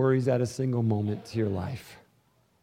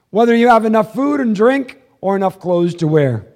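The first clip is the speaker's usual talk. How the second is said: loud, raised preacher's confession; full-force preaching; 6 semitones higher, 9 dB louder than usual. Acted. Pastor confessing to the church, loud fervent preaching.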